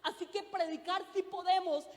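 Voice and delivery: ordinary talk, nothing marked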